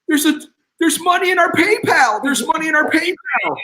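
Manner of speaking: imitating a wife squealing with joy; excited screaming. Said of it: "Jennifer starts screaming".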